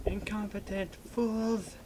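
slow mock German villain snarl; hammy movie villain German accent. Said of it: "I was about to fail at doing a German accent there".

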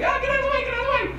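Sandra Bullock swerving the bus, mock shriek; panicked Sandra Bullock. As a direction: squeal it like a frantic driver. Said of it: "She's like".

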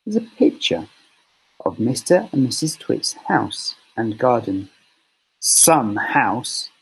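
ordinary delivery

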